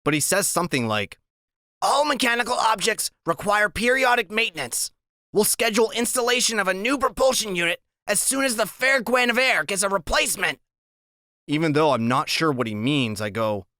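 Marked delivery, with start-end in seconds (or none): 1.8-10.6 high, precise little-genius voice reciting a definition; Freak's know-it-all voice. A narrator's reading. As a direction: lecture like a walking dictionary, high-pitched. Narrator voices Freak explaining.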